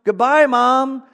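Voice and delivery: loud, high-pitched calling out; shout across store. Retold or imitated. Lady showing a shopper how to call goodbye, shouting. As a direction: holler a farewell across a big shop. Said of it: "would you please shout across the grocery store".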